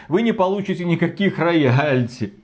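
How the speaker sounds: normal talking voice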